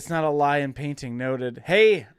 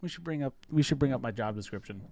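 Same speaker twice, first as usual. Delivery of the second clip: ordinary talk, nothing marked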